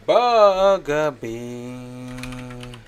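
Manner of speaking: loud, long drawn-out call. Real, not acted. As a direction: call out the nickname, stretching it slowly. Calling the child by nickname.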